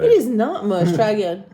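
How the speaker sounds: loud, playful insistence; indignant protest. Real, not acted. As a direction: protest it loudly, half laughing. Insisting her arms are strong.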